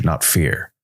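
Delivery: ordinary delivery, nothing marked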